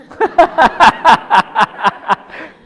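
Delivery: real loud laughter; big burst of laughter. Real, not acted. Patient picks son, not wife.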